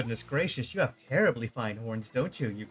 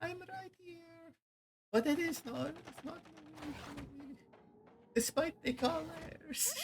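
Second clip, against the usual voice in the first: much higher and much softer; tiny character voice; 11 dB quieter than usual. Acted. Player voicing a small character, high and soft. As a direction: pipe up like a small creature.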